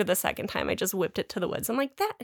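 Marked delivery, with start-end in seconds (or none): 2.0-2.1 high, fast mock outrage; comic indignant squawk, rushed and high; mock indignation. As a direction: protest the insult in a raised pitch. Mock outrage at biting snake.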